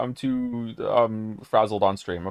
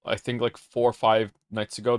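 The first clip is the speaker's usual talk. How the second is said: normal talking voice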